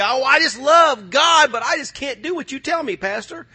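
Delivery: high, loud whiny mock of a churchgoer; hammed-up excuse of a reluctant member. Mocking a reluctant congregant.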